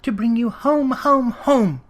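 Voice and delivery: put-on high, singing child's voice repeating home; bubbling over with joy; gleeful little girl. A narrator's reading. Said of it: "brimful of glee".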